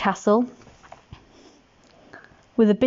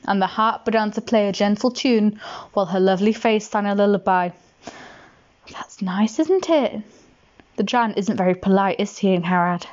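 usual speaking voice

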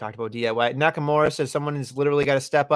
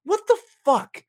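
high, slow what the fuck; retold disbelief; 3 dB louder than usual. Podcaster retelling his own shocked reaction, high and slow. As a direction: stretch it out in shock. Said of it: "I was just thinking to myself".

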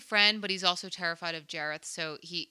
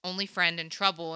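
normal talking voice

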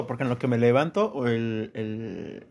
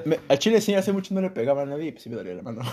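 ordinary talk

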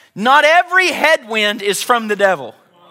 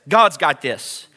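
low, settled reassurance; peaceful; 7 semitones lower, slower than usual. Acted. Preacher showing how Peace talks, low and calm. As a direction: drop the pitch and rest in it. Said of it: "Peace talks like this".

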